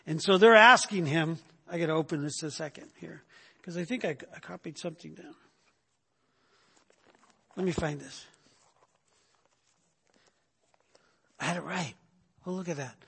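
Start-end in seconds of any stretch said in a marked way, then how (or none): 1.7-13.0 much softer, half to himself; muttered aside. Real, not acted. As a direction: mutter while hunting through your notes. Searching notes, muttering aside.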